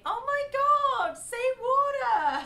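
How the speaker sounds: hammed-up excited American; mock gushing American. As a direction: gush over the accent like an American fan. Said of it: "the first thing they do is say".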